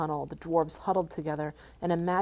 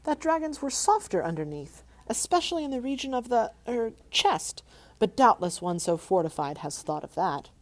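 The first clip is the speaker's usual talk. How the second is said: frightened squeak; 6 semitones higher, 4 dB louder than usual. Acted. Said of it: "in a frightened squeak".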